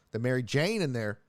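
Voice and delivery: ordinary talk, nothing marked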